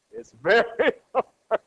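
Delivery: slow "very" breaking into a laugh; laughing emphasis. Real, not acted. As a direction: laugh through the admission. Laughs while answering own question.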